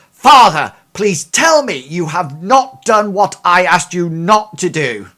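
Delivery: loud, furious plea at a father; angry demand. Acted. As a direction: slam the table and demand an answer. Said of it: "she whacks her fist down on the table and she says".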